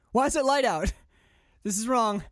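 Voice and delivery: high, loud, bewildered protest; hammed-up tripping alarm; mock freak-out. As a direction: play someone starting to panic on a trip. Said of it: "someone starts to freak out".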